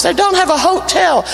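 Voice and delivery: normal talking voice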